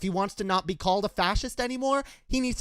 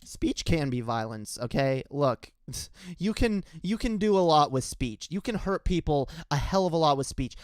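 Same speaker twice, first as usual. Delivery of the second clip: usual speaking voice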